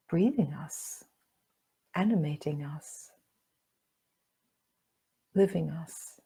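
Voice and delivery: calm meditation guide with long pauses; guided meditation. Acted. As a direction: guide them gently inward, leaving room to breathe.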